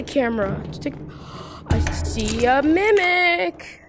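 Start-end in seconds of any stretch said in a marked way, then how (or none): 1.0-1.6 quick unvoiced gasp of alarm; sharp startled gasp. Real, not acted. Gamer gasping on spotting the mimic. 1.7-3.8 higher, slow, drawn-out alarm. Real, not acted. Gamer alarmed on seeing a mimic, drawn out.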